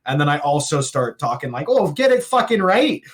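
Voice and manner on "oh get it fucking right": high-pitched mock Canadian country drawl; hammed-up tipsy Canadian accent; drunk Canadian. Imitating himself talking after drinks, drunk Canadian. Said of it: "things that kinda sound remotely Canadian".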